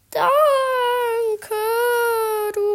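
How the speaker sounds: high loud teary shadow voice; howled thanks. Acted. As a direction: wail the thanks, still sniffling. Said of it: "die der Schatten nun heulte".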